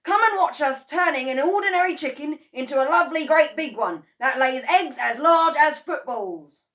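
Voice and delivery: high, loud bragging; excited boasting. A narrator's reading. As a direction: sell the giant chicken. Mr Kranky boasting to wife.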